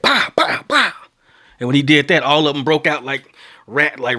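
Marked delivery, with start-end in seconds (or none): 0.0-0.9 high, punchy mock gunfire; imitated gunshots. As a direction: fire off mouth-made gunshots. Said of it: "fired over their head".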